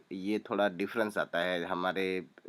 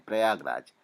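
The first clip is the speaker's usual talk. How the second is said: ordinary delivery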